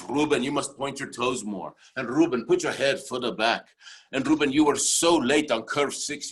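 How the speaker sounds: stern Austrian coach giving orders; Arnold Schwarzenegger. Retold or imitated. Coach correcting luger over walkie-talkie, Austrian accent. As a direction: Austrian accent, firm commands. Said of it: "sounds like Arnold Schwarzenegger".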